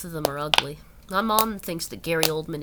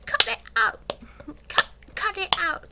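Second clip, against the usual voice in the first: high-pitched bad Cockney; silly put-on Cockney, high and halting; bashful Cockney impression; 11 semitones higher than usual. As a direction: fake a shy Cockney plea to stop teasing. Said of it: "Cockney accent without something, sounding stupid".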